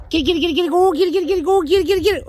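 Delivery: high, fast, eager get it chant; excited dog hype. Real, not acted. Trainer urging his dog after the ball, high excited chant. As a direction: rev the dog up to chase.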